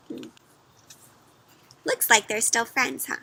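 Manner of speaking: ordinary delivery, nothing marked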